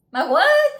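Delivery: high, loud, stunned "My what?"; a sharp squeak of disbelief; shocked disbelief. Retold or imitated. Worker threatened with losing her ears, asks high and loud.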